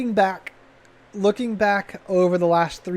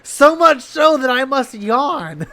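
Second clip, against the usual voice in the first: slow, laughing fake-bored line; laughing mock boredom; 7 semitones higher, 5 dB louder, slower than usual. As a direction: say it slowly while laughing.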